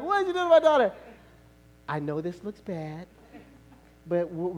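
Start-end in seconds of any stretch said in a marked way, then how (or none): 0.0-0.9 high, loud mock shriek; outraged mother. Wife confronting husband over hurt daughter, outraged. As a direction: explode in accusation. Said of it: "she's gonna be like".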